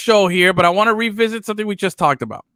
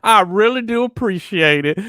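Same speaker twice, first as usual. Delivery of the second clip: higher, slow exaggerated Southern charm; mock Southern drawl; 7 semitones higher, slower than usual. Host mocking coach's new accent, Southern drawl. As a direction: lay the polite drawl on thick. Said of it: "host echoes the mock drawl".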